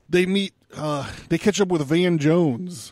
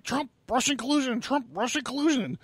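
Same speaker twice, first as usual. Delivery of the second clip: mocking parrot; 8 semitones higher, 4 dB quieter than usual. Mocking Van Jones on CNN.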